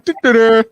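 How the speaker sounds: loud, high mimicked da-da-da; loud sung-out mock noise; imitated "da, da, da". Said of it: "Walk around going".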